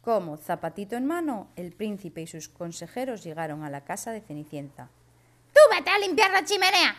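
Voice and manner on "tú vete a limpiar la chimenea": very high, loud shrill stepmother; wicked stepmother. Acted. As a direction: bark the order like a nasty stepmother. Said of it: "le dijo la madrastra a Cenicienta".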